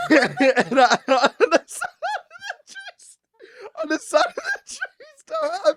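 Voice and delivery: high, wheezing through the words; laughing too hard to finish; real helpless laughter. Real, not acted. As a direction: struggle to speak while cracking up. Cracks up retelling his accident.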